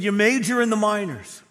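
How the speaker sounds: "You major in the minors" shouted high and loud, punching "major"; preaching at full force. Acted. Pastor voicing Jesus' rebuke of Pharisees, "You major in the minors" at full force. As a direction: hammer "major" at full pulpit volume.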